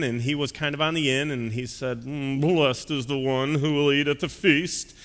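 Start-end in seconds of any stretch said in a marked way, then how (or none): 2.0-4.9 affluent guest's clenched-teeth drawl; pompous well-to-do man, drawn out at the end; Thurston Howell III. Acted. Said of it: "might have had a voice similar".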